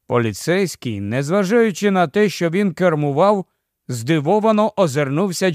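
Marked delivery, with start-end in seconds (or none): none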